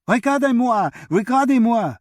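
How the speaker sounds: put-on high, loud child's call in French; boy's yell in French. A narrator's reading. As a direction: shout it out like an excited boy showing off. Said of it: "the boy yelled in a perfect French accent".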